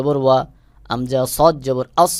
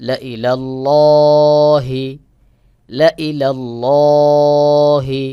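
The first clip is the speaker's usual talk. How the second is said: Quran recitation demo; 5 dB louder than usual. Shown as a demonstration. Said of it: "স্বাভাবিকভাবে পড়বেন".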